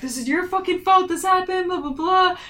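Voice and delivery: high-pitched mock scolding; imitating angry rant. Sasha blaming him for falling asleep on watch, mock angry. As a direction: imitate someone furiously blaming a friend. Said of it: "Sasha's so mad at him".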